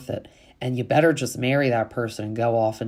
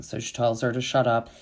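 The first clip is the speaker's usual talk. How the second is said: usual speaking voice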